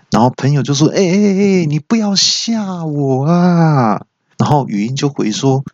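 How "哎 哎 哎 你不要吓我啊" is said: nervous friend. Retold or imitated. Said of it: "就更紧张了啊".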